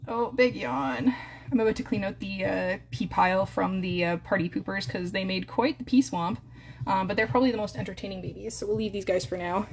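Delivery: ordinary talk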